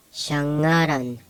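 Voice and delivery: shangaran slowly, NG as one sound. Shown as a demonstration. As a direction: say shangaran with NG and no hard G. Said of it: "It's pronounced".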